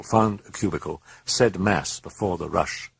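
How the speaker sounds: usual speaking voice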